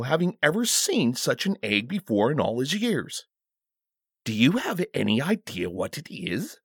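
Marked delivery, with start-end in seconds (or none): none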